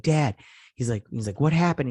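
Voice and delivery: high, loud, alarmed questions; panicked question. Retold or imitated. Worried son arriving home, loud alarmed questions. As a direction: burst in demanding answers.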